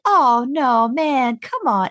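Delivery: high, loud puppyish whine; cute whiny Sam. Retold or imitated. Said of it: "He's like".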